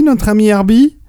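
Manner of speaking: ordinary talk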